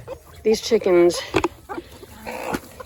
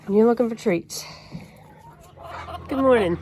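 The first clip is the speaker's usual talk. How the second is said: talking to animals; 4 semitones higher, 4 dB quieter than usual. Real, not acted.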